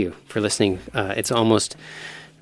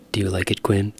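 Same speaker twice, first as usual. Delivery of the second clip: ordinary talk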